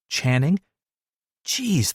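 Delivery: usual speaking voice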